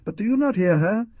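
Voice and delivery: high, loud, wild howl; raving prisoner's howl. Acted. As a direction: howl it up at the sky. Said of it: "howled in an accent that I will never forget".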